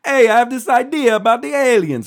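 high, loud hammed-up Italian accent. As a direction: do Fermi with a big Italian accent. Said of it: "I assume he had an Italian accent".

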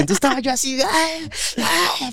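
high, retold furious sobbing yells; crying rage cries. Boy beating the bully while crying, raging yells. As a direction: bawl with fury as you swing. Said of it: "como llorando y con coraje".

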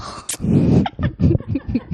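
breathy laugh. Real, not acted. Laughing at own wording.

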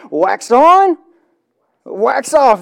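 lightly acted, loud karate master's instruction; retold coach's command.

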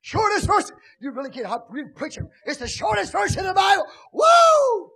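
hammed-up high shout, ending in a whoop; mock preacher shouting. Mocking a preacher shouting to a foreign crowd, hammed-up. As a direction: ham up a preacher working the crowd. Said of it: "they're not shouting with you".

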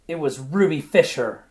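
wife crying out her own name to her husband; loud cry. A narrator's reading. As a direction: cry it out, insisting. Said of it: "cried Ruby".

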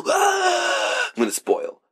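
laughing, high, slow shout of a tease. Real, not acted. Teasing his friend about spoilers with a laughing high shout. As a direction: crack up while yelling the threat.

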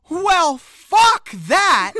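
loud, drawn-out "Well, fuck that"; shouted line. Retold or imitated. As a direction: belt "fuck" out slowly and loud. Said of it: "actually says".

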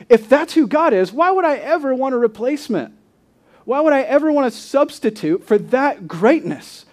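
high, loud rhetorical disbelief; incredulous. Acted. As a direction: preach it as an impassioned, disbelieving question. Preacher voices an incredulous thought.

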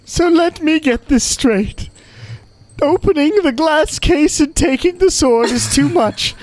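high, exasperated spirit voice; indignant cursed head. Acted. GM plays cursed head NPC.

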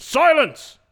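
high, loud mock judge bellow; shout. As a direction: yell for silence in court.

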